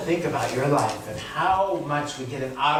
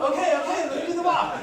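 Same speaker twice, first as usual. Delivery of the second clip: fast, higher, laughing okay okay; rushed eager agreement; 12 semitones higher, much faster than usual. Retold or imitated. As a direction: tumble out the words, giddy.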